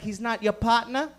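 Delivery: put-on Jamaican accent, "partner" without the r; sassy Jamaican nurse. Nurse asking about the friend, Jamaican accent. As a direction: ask in a nurse's Jamaican lilt. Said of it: "A fucking sassy Jamaican nurse".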